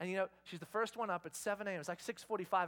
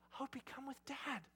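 soft, slow, higher-voiced invitation; hushed coaxing; 4 semitones higher, 10 dB quieter, slower than usual. Dad to daughter at the bedside, hushed coaxing. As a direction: coax gently, keeping it down.

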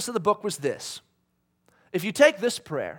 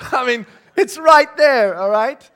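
louder, slow, still half laughing; laughing insistence; 7 semitones higher, 14 dB louder, slower than usual. Real, not acted. Pastor defends the verse to the crowd, laughing.

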